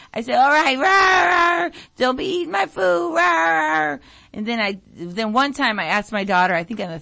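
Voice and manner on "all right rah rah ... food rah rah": high crying shout, hammed-up crazy mom; crazed mother yelling at teens over her food; acting all crazy. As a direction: scare the kids off with a wild shrieking act. Crazy act again at the kids.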